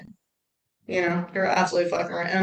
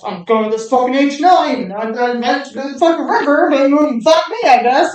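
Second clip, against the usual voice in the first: loud, higher mock grumbling; begrudging grumble; 5 semitones higher, 7 dB louder than usual. Speaker mimics someone kicking and screaming to go, mock-begrudging. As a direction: play the grudging complainer, hammed up. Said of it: "doing it begrudgingly, you fucking walk around like this".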